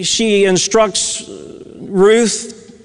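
ordinary talk

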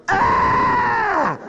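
high short mock yell. Buddies yelling as he crashes in, high mock yell. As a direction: yelp as he barrels in. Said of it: "his buddies going".

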